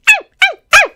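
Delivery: very high, loud cries of pain; a boy bitten on the ankle by a puppy; pained yelps. A narrator's reading. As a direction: yelp as teeth sink in.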